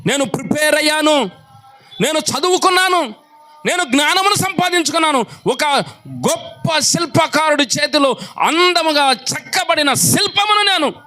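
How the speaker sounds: high, intense sermon voice; impassioned pulpit delivery; fiery preaching. Acted. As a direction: preach it out with conviction. Preaching at full force.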